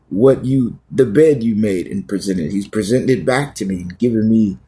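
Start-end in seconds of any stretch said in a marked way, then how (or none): none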